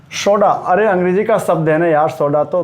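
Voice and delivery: normal talking voice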